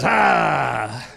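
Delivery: low, drawn-out imitated "haah"; menacing "ah". Coach cornering the runaway kid, low menacing "ah". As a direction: loose a low, triumphant villain's "ah". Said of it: "and he goes".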